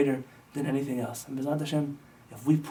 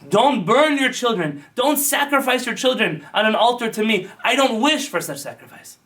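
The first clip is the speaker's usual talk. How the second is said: loud, slow, booming commandment; a loud, solemn command from on high; divine command; 8 semitones higher, 6 dB louder than usual. Acted. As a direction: thunder it out like God forbidding it. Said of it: "the Kadosh Baruch Hu already commanded us".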